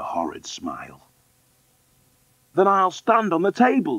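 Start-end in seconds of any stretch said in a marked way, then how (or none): 2.5-4.0 put-on high little-girl voice; plucky orphan girl. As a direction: offer it in a high child's voice. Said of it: "Deedy said".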